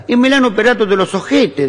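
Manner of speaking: put-on high, emphatic Italian-accented announcement; Italian husband. Italian husband explaining the operation to neighbour, high accented blurting. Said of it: "Hay un italiano".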